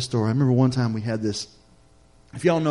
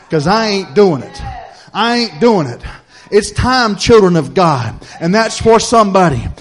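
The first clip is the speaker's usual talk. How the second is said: loud, higher, emphatic preaching; preaching at full force; 6 semitones higher, 9 dB louder than usual. Real, not acted. Preacher urging the children of God to stand, full-force preaching. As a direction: drive each phrase out to rouse the church.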